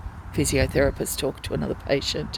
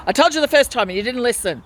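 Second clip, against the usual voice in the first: higher, louder, fast mock yell; imitating a yell; 7 semitones higher, 4 dB louder, faster than usual. Patient re-enacts the nurse shouting at her, mock yell. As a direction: fire it back like the nurse snapping. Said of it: "yelled at me".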